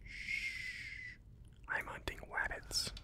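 whispering. Acted. Sneaking past sleeper.

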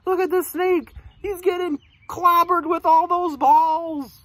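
high, dramatic storytelling; excited exclamation. A narrator's reading. Reader shows children the pelted snake, high excited exclamation. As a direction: cry out high, stretching the last words.

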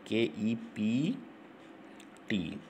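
slow letter-by-letter spelling of kept; dictating letters to students. Shown as a demonstration. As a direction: spell के ई पी टी slowly, one letter at a time. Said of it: "second form होना चाहिए".

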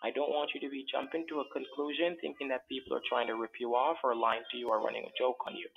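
normal talking voice

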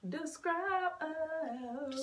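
high, very slow stretched words; drawn out. Pretend. Stalling friends on the game's task, drawn-out high delivery. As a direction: stretch it right out.

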